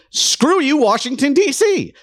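high-pitched, emphatic mock defiance; defiant declaration. Republican states to Washington, acted defiance. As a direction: voice the states telling off the capital, pitch jumping up. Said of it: "Republican states to say".

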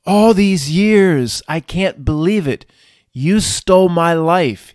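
loud, slow mock French lament; a put-on French accent, bitter and drawn out; loud, slow imitated French accent. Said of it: "I'm not gonna do the accent very long".

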